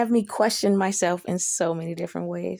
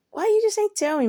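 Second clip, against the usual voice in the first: high-pitched indignant question; retold plaintive outburst; exasperated complaint; 12 semitones higher, 3 dB louder, faster than usual. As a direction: protest at being kept in the dark.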